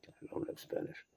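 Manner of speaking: breathy whisper outside the story; whispered aside. Real, not acted. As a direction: whisper an apology. Reader's aside on Spanish words.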